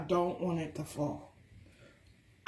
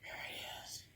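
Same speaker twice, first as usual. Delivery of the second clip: quick whisper, then softer; whispered reveal; 3 semitones lower, 21 dB quieter, much faster than usual. Real, not acted. Showing the viewers the food in a whisper.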